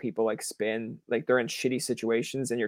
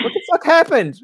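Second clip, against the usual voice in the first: much higher, louder mock shriek of disbelief; shocked outburst; 9 dB louder than usual. Host imagining his reaction to the guest's vanished plans, shrill mock disbelief. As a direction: squeal it in fake outrage.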